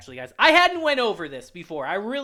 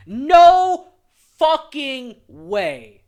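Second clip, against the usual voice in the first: loud No then a slow dragged fucking way; drawn-out angry disbelief; 4 semitones higher, 11 dB louder, much slower than usual. Real, not acted. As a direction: stretch it out in real outrage. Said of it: "I'm heated".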